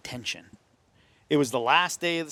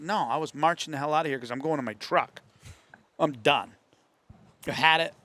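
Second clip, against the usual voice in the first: fed-up rant; 4 semitones higher, 5 dB louder than usual. Retold or imitated. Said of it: "I said".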